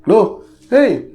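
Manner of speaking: high, loud hey at night; startled to see them out this late; surprised call. Retold or imitated. Neighbor calling out to Faiz at night, surprised.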